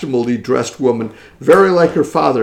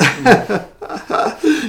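laugh; 10 semitones higher than usual. Real, not acted. Laughs at phony dressed-up ladies.

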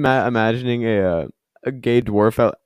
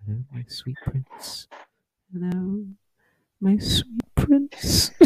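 hushed, creepy whisper, rising higher at the end; 8 semitones higher, 7 dB quieter, slower than usual. Retold or imitated. Noah leaning over whispering to Jack, creepy and hushed. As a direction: purr it strangely in his ear, slowly. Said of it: "in a very strange way and just go".

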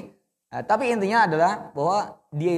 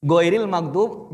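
ordinary talk

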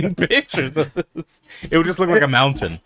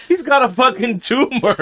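laughing; 11 semitones higher than usual. Retold or imitated. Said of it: "And Jose goes".

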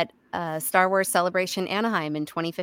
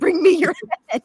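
hammed-up command; 10 semitones higher, 7 dB louder than usual. Repeating Fisher's line, laughing.

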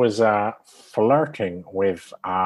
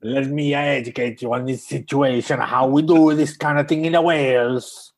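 louder, higher hammed-up Welsh imitation; mock Welsh accent; 5 semitones higher, 4 dB louder than usual. Co-host mimicking the accent specialist's clip, mock Welsh. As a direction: build into an exaggerated botched Welsh accent. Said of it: "doing his Welsh accent".

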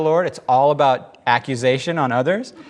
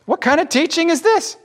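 high, thrilled questions; bubbling over with wonder; excitedly; 14 semitones higher, 4 dB louder than usual. A narrator's reading. The synagogue crowd talking excitedly, high-pitched. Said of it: "they asked excitedly".

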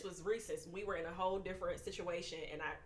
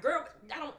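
higher, louder mock objection; imitated raised-voice protest; acted protest; 7 semitones higher, 6 dB louder than usual. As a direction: push back at a close friend. Voices pushback to her girlfriend.

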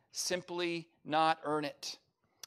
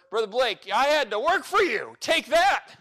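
mock boast; 7 semitones higher, 8 dB louder than usual.

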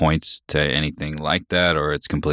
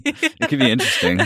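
high, laughing delivery; 8 semitones higher than usual. Real, not acted. Co-host repeating a noncommittal answer, laughing. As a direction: say it again through a rising laugh.

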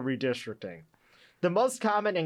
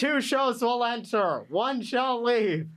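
slow, higher fight-night announcer voice; dramatic announcer; 7 semitones higher, slower than usual. Pretend. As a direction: boom it like a cage match promo. Mock showdown announcement.

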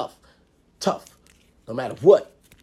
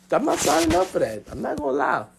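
ordinary delivery

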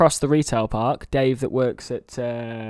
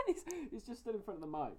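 muffled voice behind a cat; 5 semitones higher, 19 dB quieter, faster than usual. Real, not acted. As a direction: speak muffled through fur. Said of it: "if I sound muffled".

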